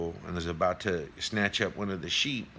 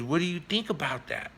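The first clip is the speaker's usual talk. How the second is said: high-pitched challenging question; acted tiger demanding an answer; big tiger; 8 semitones higher than usual. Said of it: "He goes".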